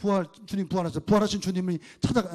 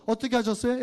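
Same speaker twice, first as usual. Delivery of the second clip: normal talking voice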